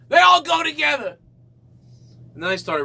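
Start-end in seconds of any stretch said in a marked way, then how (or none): 0.1-1.2 higher, louder repeat; higher paranoid rant. Real, not acted. Telling the audience, more paranoid and higher. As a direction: insist even harder.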